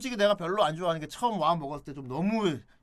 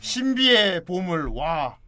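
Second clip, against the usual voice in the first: announcer voice; 2 semitones higher, 7 dB louder, much slower than usual. Retold or imitated. Quoting a cartoon opening.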